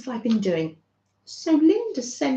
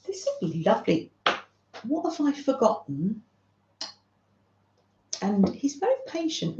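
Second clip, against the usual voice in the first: ordinary talk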